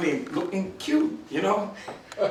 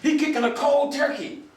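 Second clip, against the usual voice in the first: mock yell; 7 semitones higher than usual. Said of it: "somebody yells".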